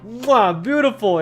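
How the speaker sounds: delighted kiss and beautiful; savoring a big win, loudly; excited. Real, not acted. Delighted at huge trading volume.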